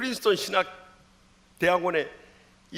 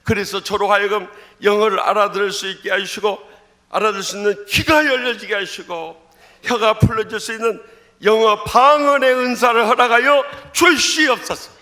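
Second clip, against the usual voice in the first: preaching shout; 2 semitones higher, 10 dB louder than usual. Acted. 열정적 설교 톤 유지. (성경 구절을 크게 천천히 외치듯 선포)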